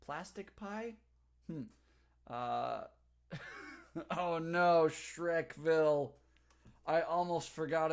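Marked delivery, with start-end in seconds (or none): 4.2-7.9 louder, drawn-out Shrekville; slow, delighted oh no; surprised. Real, not acted. Remembering an old class joke.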